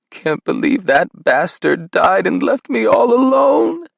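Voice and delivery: high, slow, sobbing wail. A narrator's reading. Grandmother to Simon about her husband, sobbing. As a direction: let the grief break through every word. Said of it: "she sobbed".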